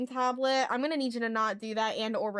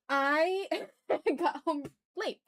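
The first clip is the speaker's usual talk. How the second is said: laughing; 5 semitones higher, much slower than usual. Real, not acted. Laughing at own lateness.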